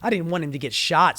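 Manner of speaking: ordinary talk, nothing marked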